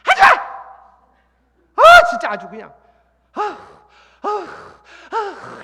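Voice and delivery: put-on loud, high exasperated snapping at the kids; mock tired parent yelling at children; irritated outburst. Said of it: "이러고". (피곤한 부모가 아이들에게 짜증내며 크게 소리침)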